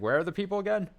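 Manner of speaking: high, rising puzzled question; mock confusion. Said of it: "I was like".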